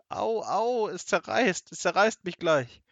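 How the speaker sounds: high, loud, agonised wailing; hammed-up cries of a bursting belly; howling in pain. A narrator's reading. Seppel shams being torn apart, howling. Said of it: "heulte Seppel".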